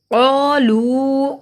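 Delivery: "قالو" chanted very slowly, both vowels held; elongated vowels. Shown as a demonstration. Teacher showing long vowels, "قالو" stretched slowly. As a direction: draw out "qaa", then hold "luu" longer. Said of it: "قا کو اس لیے لمبا کیا".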